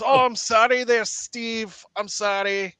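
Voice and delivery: high-pitched, sheepish apology; apologetic character voice. Acted. Clumsy character apologises to Steve.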